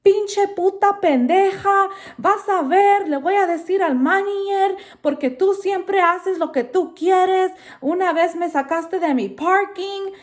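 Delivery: high, loud mock screech in Spanish; voz chillona de vecina furiosa; imitating furious neighbor. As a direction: ham up a raging neighbor yelling insults. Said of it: "she lost her shit".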